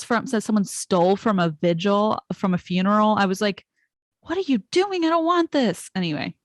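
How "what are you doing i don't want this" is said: high, exasperated protest; pitched way up, flustered; worked up. Retold or imitated. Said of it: "I'm getting worked up".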